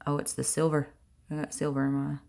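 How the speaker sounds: ordinary talk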